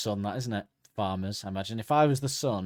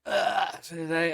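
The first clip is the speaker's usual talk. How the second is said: groaning sun; 7 semitones higher, much slower than usual. Pretend. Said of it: "he goes".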